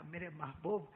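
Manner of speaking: soft, tender words to the beloved; soft and loving, as if to a dear friend; loving tenderness. Acted. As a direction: say it softly, full of love. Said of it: "اللہ نے اتنے پیار سے کہا".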